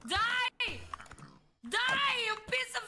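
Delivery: high furious yelling; angry. Real, not acted. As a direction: yell it at the enemy. Fighting in game.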